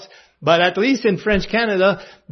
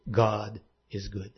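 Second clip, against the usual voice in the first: much softer, lower, slow; hushed conviction; 9 semitones lower, 11 dB quieter than usual. Real, not acted. Preacher quietly affirming faith to congregation, hushed. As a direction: say it like a quiet certainty.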